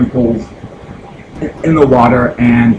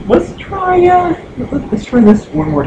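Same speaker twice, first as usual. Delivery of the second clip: high-pitched. Real, not acted. Retrying the console.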